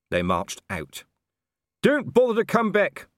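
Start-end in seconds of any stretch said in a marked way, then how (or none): 1.8-3.1 high, loud parting yell; shouting after. A narrator's reading. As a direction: call it after them. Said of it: "Colon shouted after them".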